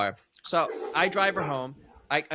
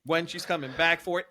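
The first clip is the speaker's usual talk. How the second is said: ordinary talk, nothing marked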